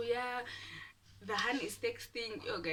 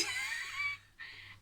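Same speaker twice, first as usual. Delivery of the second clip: squealing, high-pitched laugh. Real, not acted. Admitting to viewers he loved her, high laugh.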